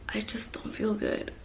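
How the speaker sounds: faint whispered reply, barely audible. Retold or imitated. Answering mom weakly again.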